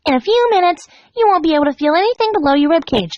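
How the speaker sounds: chirpy and sweet; Pinkie voice. Acted.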